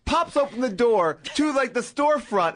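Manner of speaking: ordinary delivery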